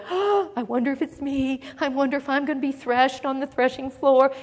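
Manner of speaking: gasp, then softly fretting; hammed-up anxious wondering, hushed; mock worry. Preacher mimics disciples fearing the threshing floor, mock worry. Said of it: "they weren't going".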